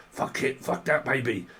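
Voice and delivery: ordinary delivery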